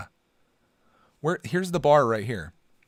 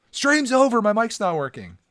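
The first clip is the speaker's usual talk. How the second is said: higher, louder fake alarm; mock panic; 10 semitones higher, 7 dB louder than usual. Streamer pranks Felix back, hammed-up louder panic. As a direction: pretend the stream is broken. That'll get him.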